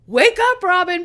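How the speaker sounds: calling through a door; loud wake-up call; mock wake-up call. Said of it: "and be like".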